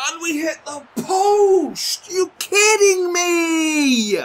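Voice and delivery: very high, very loud, slow cry; anguished shout. Real, not acted. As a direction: howl in disbelief at the post. Shot hits the post.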